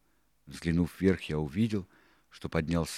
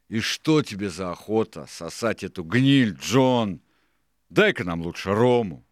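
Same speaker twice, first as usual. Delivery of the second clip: loud, high pirate grumble; scornful, raised pirate voice; Hands interrupting; 4 semitones higher, 9 dB louder than usual. A narrator's reading. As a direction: cut in loudly and push for a drink. Hands cuts in, demanding rum.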